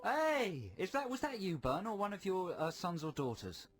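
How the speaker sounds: high, delighted curiosity; surprised query. Real, not acted. Host surprised by new caller voice.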